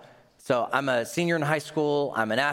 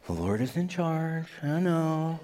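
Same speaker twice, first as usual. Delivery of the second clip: resigned shrug; 3 semitones higher than usual. Retold or imitated. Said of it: "We say".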